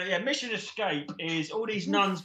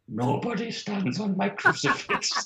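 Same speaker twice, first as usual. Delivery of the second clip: Irish nun voice; 5 semitones higher, 4 dB louder than usual. Retold or imitated. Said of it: "and she looks up and says".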